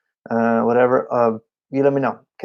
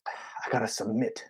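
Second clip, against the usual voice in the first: slow, much softer giving in; resigned submission; 3 semitones lower, 15 dB quieter, slower than usual. Retold or imitated. Drummer giving in to the worship leader, slow and soft. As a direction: sigh into surrender. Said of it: "you're like".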